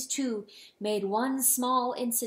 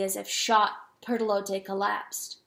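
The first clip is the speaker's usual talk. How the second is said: ordinary delivery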